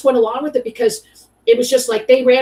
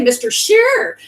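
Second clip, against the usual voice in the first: playful name call-out; 10 semitones higher, 4 dB louder, slower than usual. Pretend. Playfully calls out friend's name.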